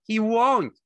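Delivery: high, loud denial; frantic cry. A narrator's reading. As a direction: cry out in panic. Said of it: "Lenny cried frantically".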